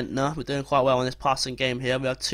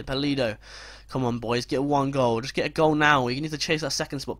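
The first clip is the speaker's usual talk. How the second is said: normal talking voice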